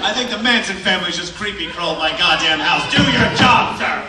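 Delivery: high, loud demand; indignant shout. Retold or imitated. To the police officers, an outraged loud demand. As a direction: bark orders at the police in outrage.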